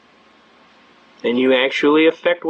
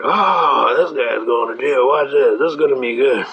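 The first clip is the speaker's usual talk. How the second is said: murmuring courtroom crowd; 2 semitones lower than usual. Retold or imitated. People in the court watching a man head for jail, mock gleeful murmur. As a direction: imitate gawkers relishing someone's trouble.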